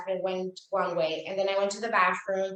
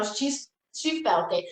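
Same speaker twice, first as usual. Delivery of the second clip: normal talking voice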